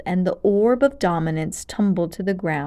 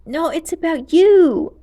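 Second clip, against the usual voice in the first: ordinary delivery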